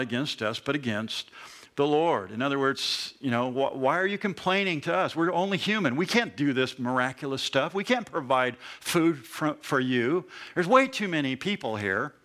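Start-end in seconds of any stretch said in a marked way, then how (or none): none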